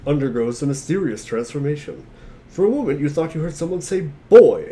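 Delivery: ordinary talk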